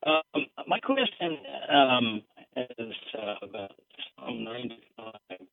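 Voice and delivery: caller's voice cutting out on the phone; faint, breaking-up phone line; bad connection. Real, not acted. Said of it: "your voice is cutting out so badly".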